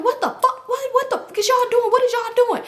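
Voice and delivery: high, laughing mock yell of a shocked guy; shocked yelling man. Dude yelling at fighting girls.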